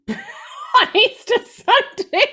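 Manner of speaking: high burst of real laughter through the words; laughing. Real, not acted. Laughing at her own Easter doubt, real loud laughter.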